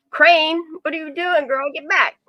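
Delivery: sharp call, get the dog back. Real, not acted.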